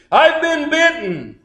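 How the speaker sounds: high-pitched retold screech of alarm. Son to his family after the burial, high acted screech. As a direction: screech it out high, as the preacher's dramatisation. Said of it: "the son screeches".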